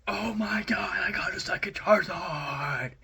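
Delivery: high, loud thrilled cry; losing it over a second Charizard; excited shout. Retold or imitated. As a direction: shriek with delight at the pull. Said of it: "I lost".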